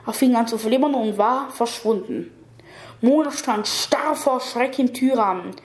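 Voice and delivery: plain reading voice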